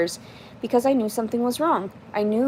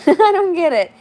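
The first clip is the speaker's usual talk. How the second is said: laughing, much higher and louder "I don't get it"; 10 semitones higher, 8 dB louder than usual. Real, not acted. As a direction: laugh out the repeat in disbelief. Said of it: "repeats laughing".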